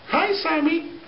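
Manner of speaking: very high falsetto greeting. Retold or imitated. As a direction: mimic a soprano's high-pitched hello. Voicing [name] greeting him.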